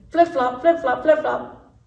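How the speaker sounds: high slow slapping steps; flip-flop sound. Acted. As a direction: slap it out like wet feet. Frog's wet steps.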